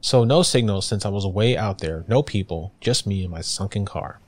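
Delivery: ordinary delivery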